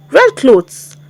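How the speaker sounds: usual speaking voice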